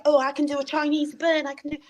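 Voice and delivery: high-pitched mock British schoolkid; British accent. Retelling schoolmates' boasts, in a put-on British accent. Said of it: "I put on a British accent".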